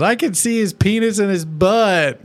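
put-on chirpy kid voice; imitating young son. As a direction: son reporting about little brother.

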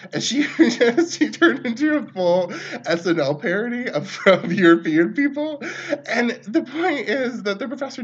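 laughing at the memory. Real, not acted. Remembering the mocked classmate, laughing.

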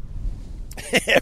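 short, soft laugh; amused laugh. Real, not acted. Reading Rachel's comment on Opie's speech impediment, soft laugh. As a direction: let out a quiet chuckle.

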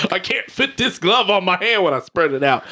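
lightly voiced Southern accent for a quote. Telling friends what O J said, in a light Southern accent. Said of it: "I gave him a southern accent".

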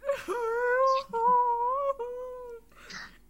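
loud high laugh-cry wail; laugh turning into sob. Retold or imitated. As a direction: let the laugh break into sobbing. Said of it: "bursted into tears".

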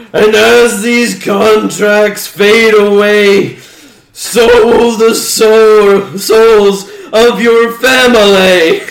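villain finishing the spell; ominous curse. Acted. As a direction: intone the curse slowly.

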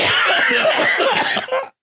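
high squealing laugh; high-pitched fit of laughter. Real, not acted. Joke about the word sac.

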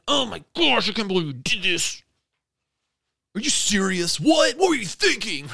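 high-pitched outraged dad impression; hammed-up scolding without full volume; mock parental yelling. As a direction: imitate a parent freaking out, voice shooting up. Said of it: "He yelled".

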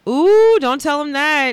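high, loud "ooh" of warning; mock scandalized. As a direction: warn them with playful alarm. Teasing warning after German remark.

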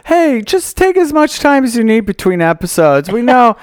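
hammed-up praise from an imaginary listener; gushing fan. Acts out an imagined fan message.

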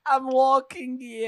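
high, slow, gruff New Yorker; New York Italian accent. Pretend. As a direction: yell it like a cabbie. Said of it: "an Italian accent".

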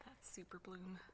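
hushed aside; whispered. Real, not acted. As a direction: whisper it under the breath. Aside during host's allergy talk.

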